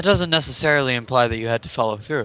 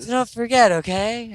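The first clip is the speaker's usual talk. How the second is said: high, dreamy voice from the past; flashback voice; 10 semitones higher than usual. Pretend. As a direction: voice a hazy memory, pitched up. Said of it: "I'm doing flashback voice".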